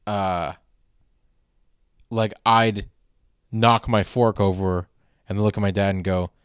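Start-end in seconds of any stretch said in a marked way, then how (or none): none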